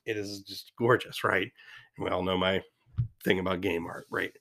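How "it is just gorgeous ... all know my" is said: ordinary talk, nothing marked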